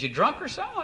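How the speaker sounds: high, disbelieving caller voice; incredulous caller. Retold or imitated. Buyer on the phone asking if he's drunk, high and incredulous.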